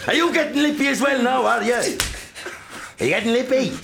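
very high, loud raging yell; father screaming at his son over lip; raging shout. Acted. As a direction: roar at the insolent son. Father berating sons.

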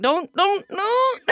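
high, pleading mock warning. Mom warning herself not to blurt, mock alarm.

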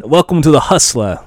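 lightly Scottish host voice; a hint of a Scottish host welcoming folks; Craig Ferguson. Host opens the show, a touch of Scottish. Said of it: "I tried to do a Craig Ferguson voice".

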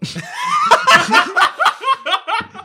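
high shrieking laugh; loud laughing fit. Real, not acted. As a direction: burst out laughing hard. Huge laugh after bad roll.

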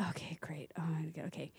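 very low, hushed self-talk; soft, low, half-whispered reluctance; wary muttered aside. Retold or imitated. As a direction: nervously mutter it under your breath. Her wary reaction in retold scene.

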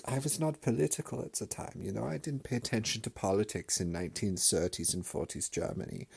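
high, hammed-up German accent, casually innocent; mock German grandpa. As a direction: play the grandpa explaining away Mein Kampf. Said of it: "and he'll be like".

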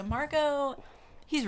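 high, loud emphatic name. Real, not acted. Podcaster hitting a character's name loudly and high. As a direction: announce the name with a loud jump up.

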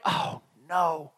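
soft, low mock dismay; dismayed aside. Preacher acts out regretting what slipped from his mouth, dismayed aside. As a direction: sink low and quiet in pretend regret.

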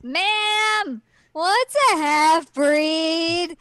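put-on high, loud little-kid voice; tiny Inuyasha. Host playing a tiny child questioning his mother, high and drawn out. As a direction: call out slowly like a small child asking. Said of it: "He's a little tiny Inuyasha".

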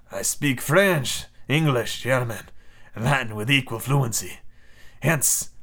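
slow, measured attempt at a French accent; badly faked French accent for a commander; shitty French accent. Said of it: "Nemo's gonna have a shitty French accent".

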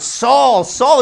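the voice of God booming Saul's name. Acted. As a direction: boom the name down from heaven. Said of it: "the voice starts booming out".

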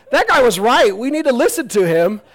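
high, loud, fervent advocacy; playfully overexcited declaration; acted insistence. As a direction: insist on it like an eager champion. Acts Galileo championing Copernicus.

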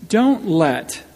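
ordinary delivery

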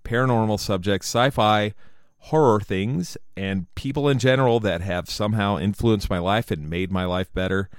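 loud, high announcer welcome; big upbeat host intro; podcast intro. Acted. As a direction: sell the show like a radio host.

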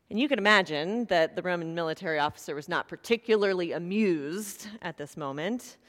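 normal talking voice

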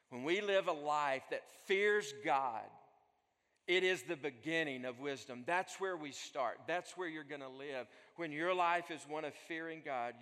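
impassioned sermon crescendo; preaching at full force. Acted. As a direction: build it up like a pulpit climax. Preaching emphasis.

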